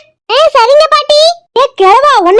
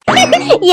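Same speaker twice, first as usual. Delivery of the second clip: mocking giggle; 4 semitones higher than usual. Mother-in-law mocks husband's choice.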